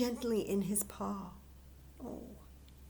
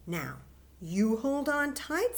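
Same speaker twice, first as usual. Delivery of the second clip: stern; 6 semitones higher, 4 dB louder than usual. Acted. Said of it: "said Fletcher sternly".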